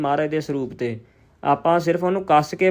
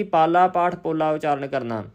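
ordinary talk, nothing marked

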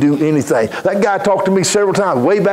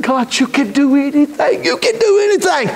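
higher, rising preacher's declaration; preaching cry; 10 semitones higher than usual. Acted. Preacher telling God he can do anything. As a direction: proclaim it, building.

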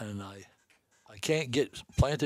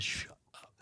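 retold repeat; 10 dB quieter than usual. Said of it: "I said".

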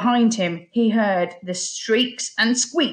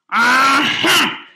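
loud, higher pirate exclamation; pirate's cry; 6 semitones higher, 6 dB louder than usual. A narrator's reading. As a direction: cry out like a villain pouncing. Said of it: "cried Barnacle Bill".

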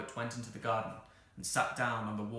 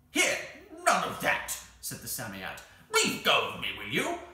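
loud, high-pitched protesting creature; indignant creature; 6 semitones higher, 9 dB louder than usual. Acted. The caught Psammead protests to Anthea, indignant. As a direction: burst out crossly as the grabbed creature.

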